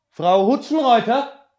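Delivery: very high, loud drawn-out call. Acted. Ruft die Vermieterin. (laut und gedehnt nach der Vermieterin rufen)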